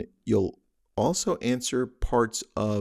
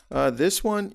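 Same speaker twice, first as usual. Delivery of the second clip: ordinary talk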